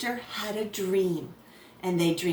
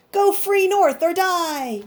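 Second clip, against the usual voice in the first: louder, higher and slow; dramatic proclamation; 8 semitones higher, 6 dB louder, slower than usual. Acted. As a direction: announce it like a warning in lights. Message read dramatically.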